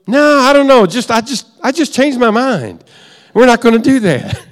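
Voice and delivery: high-pitched, offhand mock husband; breezy dismissal. Husband telling wife he changed his mind, breezily dismissive.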